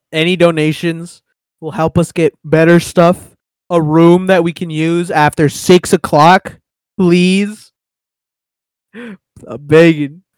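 loud, begging for donations; loud pleading. Real, not acted. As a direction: plead loudly, half joking.

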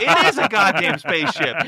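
his own dazed echo of the magician's shout; awed agreement. Retold or imitated. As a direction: repeat it back, amazed. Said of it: "And I was like".

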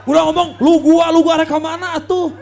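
loud mock slang. Mocking youth slang, laughing.

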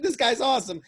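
giddy praise; excited. Retold or imitated. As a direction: shout the praise out. Excited reaction.

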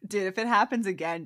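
ordinary delivery

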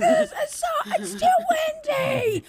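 put-on high, wailing kid complaint; whining kids. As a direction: imitate kids shrieking in the car. Kids screaming about the windows.

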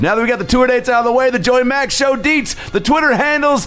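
high, hyped-up show intro; punchy, high-pitched host read; podcast announcer. Acted. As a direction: announce the episode like a ring announcer.

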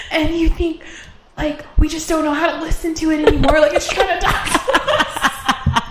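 high voice through wheezing laughter; wheezy voice. Real, not acted. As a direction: talk while wheezing with laughter. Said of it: "I keep wheezing".

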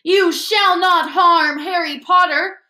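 high, loud, drawn-out command; shouting elf. A narrator's reading. Elf protecting Harry from his former master, shouting. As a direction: shout it slowly as a defiant elf. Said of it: "But Dobby shouted".

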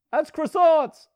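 high squeaky joke line; a light mock French tag; mock French quip. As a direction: toss it off as a silly French aside. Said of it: "Okay, close enough".

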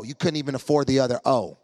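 ordinary talk

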